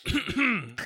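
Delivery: shrill screeching laugh burst; pterodactyl shriek. Real, not acted. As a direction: let out a high screech of a laugh. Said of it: "That was a pterodactyl scream".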